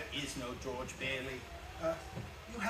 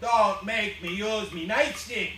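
threat; 5 semitones higher, 10 dB louder, slower than usual. Acted. Cop threatens.

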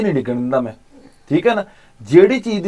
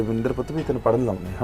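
softer, tender preview of a talk with the niece; gentle coaxing; 4 semitones lower, 9 dB quieter than usual. Retold or imitated. Uncle rehearsing what he'll tell his niece, soft and tender. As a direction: speak it softly, as if comforting a child. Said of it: "ਉਹਨੂੰ ਕਹਿਣਾ ਗਾ ਵੀ ਐਂ ਕਰਕੇ".

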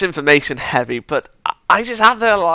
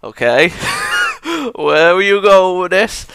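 laughing disbelief; 6 semitones higher, 3 dB louder than usual. Retold or imitated. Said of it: "I was just like".

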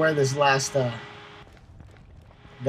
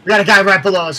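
alarmed shout; 5 semitones higher, 12 dB louder, faster than usual. Real, not acted. Spotted enemy below, alarm.

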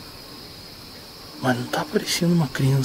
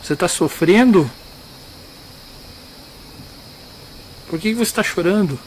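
calling out; 4 semitones higher than usual. Real, not acted. Calling out to crying spirit.

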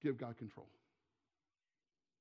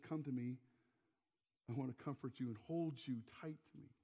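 soft, low, fatherly invitation; quiet, reassuring acted quote; tender comfort; 6 semitones lower, 9 dB quieter than usual. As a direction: voice God gently drawing a child close. Voicing God comforting a child.

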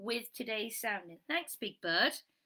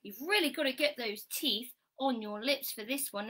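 usual speaking voice

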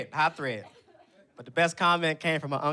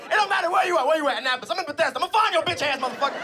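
high, loud threatening aunt; Aunt Kathy; 9 semitones higher, 3 dB louder, faster than usual. Retold or imitated. Aunt threatening to find her nephew's hater, mock furious rant. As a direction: imitate an aunt ranting at full tilt. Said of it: "That's what she said".

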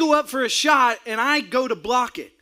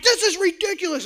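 ranting; 7 semitones higher than usual. Retold or imitated. Ranting at bench.

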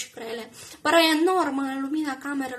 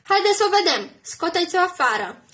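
loud, higher jeering shout; rowdy demand; 4 semitones higher, 7 dB louder than usual. Acted. Drunk woman yells for Alice to be brought out, jeering. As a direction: egg the mob on.

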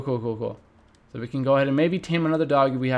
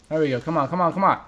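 normal talking voice

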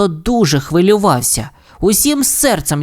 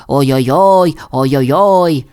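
mock pained wail; slower than usual. A narrator's reading. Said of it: "кричав".

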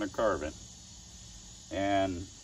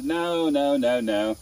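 imitated protest; 6 semitones higher, 6 dB louder, slower than usual. Student protesting, voiced.

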